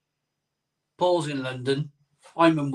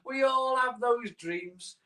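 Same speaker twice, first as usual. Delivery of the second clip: dreamy; 7 semitones higher, 5 dB quieter, slower than usual. Acted. Dreamy repeat, slower and higher.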